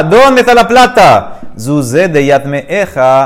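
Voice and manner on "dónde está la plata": pitched up, pressing demand for the money; urgent question. Acted. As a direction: insist on knowing where it is. Son urgently asks dead father. (pregunta urgente, voz más aguda)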